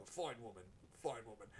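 low, soft mock-solemn murmur; hammed-up grave nod of respect; solemn old men. Old soldiers honoring the dead wife, low mock-solemn murmur. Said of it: "they look at each other and go".

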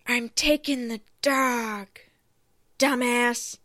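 Jennifer Coolidge impression. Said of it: "my Jennifer Coolidge impression".

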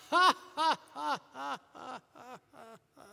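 loud, high mocking ha-ha that fades out; hammed-up holy laughter impression; mock preacher laughter. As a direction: imitate a preacher's forced laugh. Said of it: "He started laughing".

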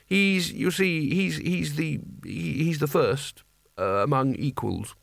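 slow, faltering stammer, sinking in pitch; halting explanation that winds down; voice running down. A narrator's reading. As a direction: lose your nerve and peter out. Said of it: "Carding's voice ran down slowly".

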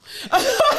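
high squeal of laughter. Real, not acted. Laughing to her friend after the period story. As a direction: cracks up at her own punchline.

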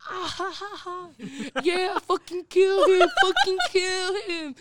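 high mock cackle, then drawn-out loud cheering; Jay. Jay laughing along at the threat, gleeful. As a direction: imitate a friend laughing and egging on a fight. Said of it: "Jay was just laughing".

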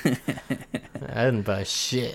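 very slow, soft sheepish denial; drawn-out denial. Retold or imitated. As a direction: drag it out slowly. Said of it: "And I'm just like".

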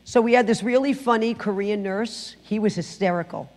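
ordinary talk, nothing marked

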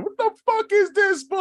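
very high-pitched retold outburst; flabbergasted yelp, cut short; stunned. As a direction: act out stunned disbelief. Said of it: "literally stunned".

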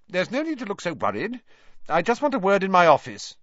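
high, quick schoolmistress voice; brisk teacher. A narrator's reading. Professor McGonagall telling pupils not to look worried, brisk. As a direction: reassure briskly, no time to waste.

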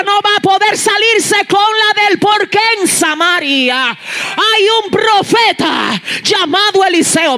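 slow, loud, high proclamation; proclaiming. Real, not acted. Preacher proclaiming the prophet to the church, loudly. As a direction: announce the prophet with weight.

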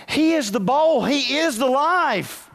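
high, loud preacher's hammering; full-force preaching. Acted. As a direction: drive the point home to the church.

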